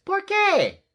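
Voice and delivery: very high, loud query; high-voiced question. A narrator's reading. Skipito questions the Chihuahuas, high-voiced asking. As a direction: ask it loudly in a little high voice. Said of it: "asked Skipito".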